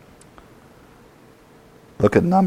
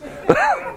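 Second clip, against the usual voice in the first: short high laugh. Real, not acted.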